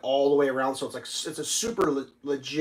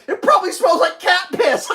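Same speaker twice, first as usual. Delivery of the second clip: high, laughing clerk voice; laughing mock clerk; 7 dB louder than usual. Clerk teasing him about the case smell, laughing high. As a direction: tease him while cracking up.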